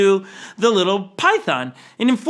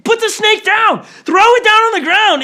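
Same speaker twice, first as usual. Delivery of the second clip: higher, louder panicked yell; freaking out; 13 semitones higher, 7 dB louder than usual. Retold or imitated. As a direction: yell at the kid to drop the snake. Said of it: "he starts to freak out on him".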